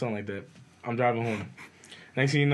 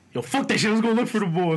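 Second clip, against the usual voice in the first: angry; 8 semitones higher, 4 dB louder than usual. Retold or imitated. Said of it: "I started getting angry".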